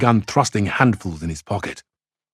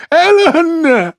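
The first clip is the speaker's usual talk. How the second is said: a woman shrieking a name, much higher and louder, tears in it; tearful shriek; 8 dB louder than usual. A narrator's reading. Woman in the crowd screaming for her child, tearful shriek. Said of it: "shrieked a woman in the crowd with tears in her voice".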